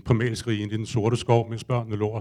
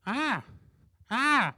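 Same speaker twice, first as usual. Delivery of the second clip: much higher, softer bird calls; bird cry; 13 semitones higher, 3 dB quieter than usual. Retold or imitated. The bird calling at him when his focus slips, much higher bird cry. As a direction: voice the unseen bird crying ah. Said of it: "der sagde".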